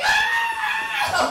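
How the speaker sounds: very high, loud swooning shriek; crowd scream. Retold or imitated. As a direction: scream like an adoring audience. Audience swoons over rival's poem.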